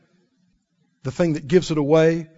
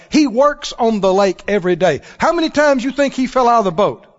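usual speaking voice

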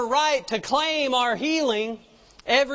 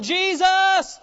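high, loud cry of the name; acted beggar's holler; 8 semitones higher, 5 dB louder than usual. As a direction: call out hard, pitch way up. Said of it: "so they keep hollering".

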